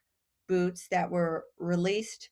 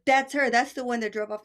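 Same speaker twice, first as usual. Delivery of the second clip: loud accusing; 4 semitones higher, 6 dB louder than usual. Retold or imitated. Cab driver accusing, loud.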